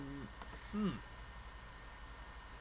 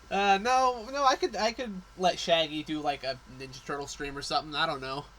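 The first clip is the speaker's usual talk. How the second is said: usual speaking voice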